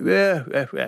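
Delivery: lazy, grand upper-class yeahs, mock-aristocratic; a playful send-up of a haughty Edwardian accent; haughty Edwardian drawl. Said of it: "this very haute Edwardian drawl".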